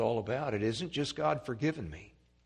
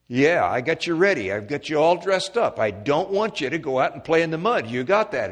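louder, firm mother's warning; scolding mother; 5 semitones higher, 9 dB louder than usual. Acted. Mother warning her son before church, firm scolding. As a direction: lay down the law to your boy.

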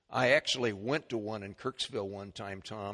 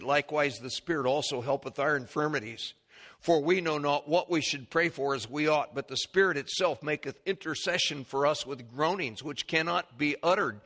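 rising, louder formal Bible reading; scripture reading; 5 semitones higher, 4 dB louder than usual. Acted. Preacher reading Romans to the congregation, building. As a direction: read it with growing fervor.

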